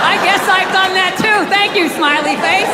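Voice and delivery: lightly voiced syrupy gratitude; mock sweet thanks. Comedian thanking the smiley face on his dashboard, sarcastic and sweet. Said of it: "driving down the street like".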